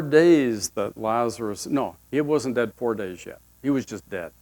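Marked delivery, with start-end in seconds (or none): none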